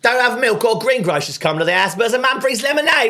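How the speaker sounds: high, loud exasperated rant; channeling the husband. Retold or imitated. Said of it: "I was channeling there".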